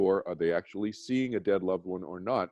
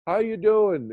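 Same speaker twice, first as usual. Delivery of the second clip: much higher and louder, suddenly perked up; imitating his dying father; 11 semitones higher, 6 dB louder, slower than usual. Son imitating his dying father answering a friend's call, high and loud. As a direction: burst into a bright, high, loud greeting like a man revived. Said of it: "He would talk just like this".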